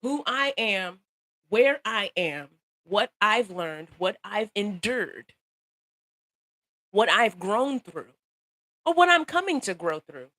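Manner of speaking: slow, each phrase weighed; measured pause-filled listing; slow deliberate list. Real, not acted. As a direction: lay out each item slowly. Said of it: "deliberate litany".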